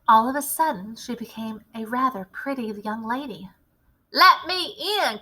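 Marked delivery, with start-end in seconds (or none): 4.1-5.2 a loud, higher call through the doors; greedy boy calling out; boy crying out. Acted. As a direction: demand to be let in. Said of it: "cried the boy's voice".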